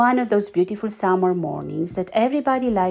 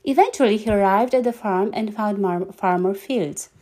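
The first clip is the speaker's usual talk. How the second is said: usual speaking voice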